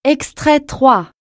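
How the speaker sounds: ordinary delivery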